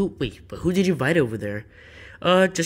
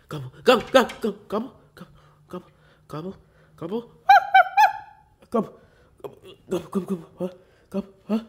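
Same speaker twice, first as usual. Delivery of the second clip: turkey gobbling; 6 semitones higher, 6 dB louder, faster than usual. Acted. Turkey imitation in flashback.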